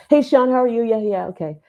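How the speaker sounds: high, eager pickup voice; acting out answering an important call brightly; chirpy phone greeting. Acting out her nervous phone answer.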